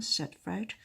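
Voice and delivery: usual speaking voice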